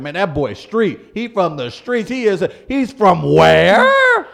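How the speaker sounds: voiced street talk rising to a loud, high "WHERE"; everybody's disbelief. Acting out fans learning Idris Elba's origins, mock disbelief. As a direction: build to hammed-up incredulous shock. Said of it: "Everybody's like".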